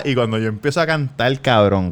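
usual speaking voice

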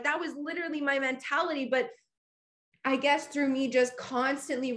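normal talking voice

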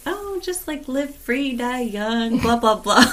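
high, airy party voice; carefree past self. Retold or imitated. Friend mocking her carefree pre-baby self, high and airy. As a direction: breeze through like a reckless teen. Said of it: "I was like".

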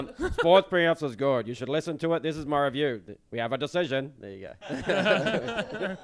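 put-on Jared Maxwell accent. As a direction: announce it like the video ref calling a decision. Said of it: "I'm gonna do the Jared Maxwell accent".

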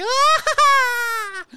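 very high, loud mock scream through a laugh; laughing falsetto shriek; screaming like a woman. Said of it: "sounds like a woman as he's screaming".

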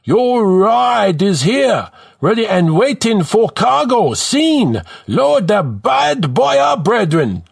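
loud, high, stretched-out Jamaican bellow; exaggerated Jamaican accent. A narrator's reading. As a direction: holler proudly in a hammed-up Jamaican accent. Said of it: "he bellows in an exaggerated Jamaican accent".

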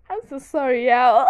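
loud, high, laughing out the sorry; laughing apology. Real, not acted. Apologizing to her viewers while laughing. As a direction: giggles through an apology.

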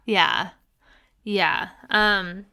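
ordinary delivery

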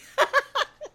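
loud, high burst of laughter; real laugh. Real, not acted. Host laughing at her own droopy-skin joke, real laugh. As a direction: crack up at your own joke.

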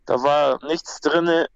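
ordinary talk

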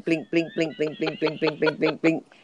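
blink repeated like a code signal; rapid-fire repetition. Retold or imitated. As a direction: tap out each blink in even rhythm. Imitating someone blinking out code.

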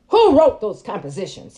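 loud, stern accusing question; accusing shout. Retold or imitated. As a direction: bark the question at her. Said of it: "it was like terror".

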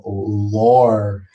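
LORE stretched very long; drawn-out intonation. Real, not acted. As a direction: draw LORE out slowly with mock weight. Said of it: "Tem que falar com entonação".

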